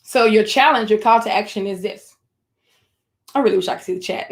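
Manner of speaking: usual speaking voice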